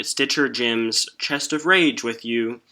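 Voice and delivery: ordinary delivery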